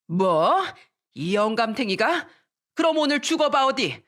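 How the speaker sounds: acted outraged wife, rising in pitch; enraged threat. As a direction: build from disbelief to a threat.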